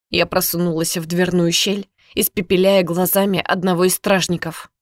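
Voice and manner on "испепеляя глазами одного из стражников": ordinary delivery, nothing marked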